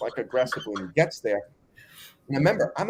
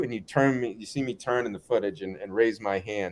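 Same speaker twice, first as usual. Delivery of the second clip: usual speaking voice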